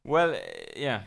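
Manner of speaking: distant, away from the microphone; sounding off-mic; far from mic. Real, not acted. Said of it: "Have you stepped away from the microphone".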